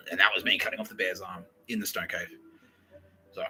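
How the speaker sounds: ordinary talk, nothing marked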